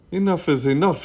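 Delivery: fed up. Acted. Frank scolds noisy Bean.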